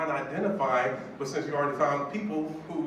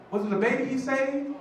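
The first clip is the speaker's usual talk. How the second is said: high-pitched imitation of a stunned woman; acted dawning amazement; astonished realization; 13 semitones higher than usual. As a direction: ask it in rising disbelief, voice pitched way up. Woman realizing who is calling.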